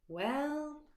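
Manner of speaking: ordinary delivery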